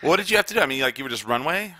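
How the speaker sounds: ordinary talk, nothing marked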